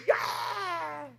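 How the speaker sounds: long, drawn-out high cry of delight; joyful exclamation. Retold or imitated. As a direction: savor it like a dream come true. Joy at first salary. (길게 늘인 기쁨의 탄성)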